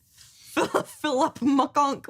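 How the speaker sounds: "Philip McConk" garbled, loud and drawn out; teasing mispronunciation. Retold or imitated. Host teases co-host's fumbled name, drawing out "Philip McConk" loudly.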